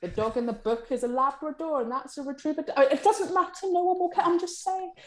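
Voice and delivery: high, fussy, nitpicking voice; pedantic editor. Retold or imitated. Editorial head nitpicking the cover dog, high and fussy. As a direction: play the fussy editor correcting the dog breed. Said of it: "And editorial will go".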